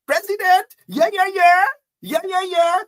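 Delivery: high, loud mocking cheer of a gullible crowd; mock the fans cheering their president; hammed-up crowd yelling President, yeah yeah yeah. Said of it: "and say".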